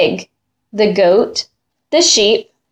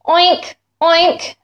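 higher grunting oink; pig oink; 6 semitones higher than usual. Acted. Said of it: "said the pig".

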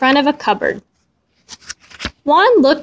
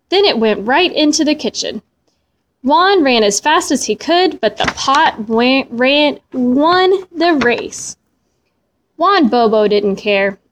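plain reading voice

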